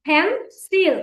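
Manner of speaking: "ফেন্সিল" in two separate beats, ফেন, then সিল; split into syllables. Shown as a demonstration. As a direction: break "ফেন্সিল" in two for learners. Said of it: "আমরা এটাকে দুই ভাবে". ("ফেন্সিল" দুই ভাগে আলাদা করে বলা)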